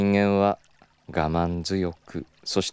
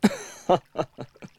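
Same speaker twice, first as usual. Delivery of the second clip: amused laugh; 7 semitones higher than usual. Acted. Amused at being caught.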